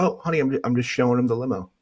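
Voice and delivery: high-pitched mock excuse to a wife; imitated placating husband. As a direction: plead innocence in a put-on high voice. Man placating his wife, acted out.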